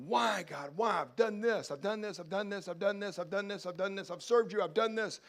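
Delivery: high, loud, frustrated pleading to God; anguished cry. Acted. Frustrated believer crying out to God.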